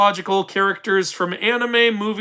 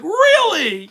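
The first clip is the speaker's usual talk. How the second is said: surprised fan; 11 semitones higher, 4 dB louder than usual. Retold or imitated.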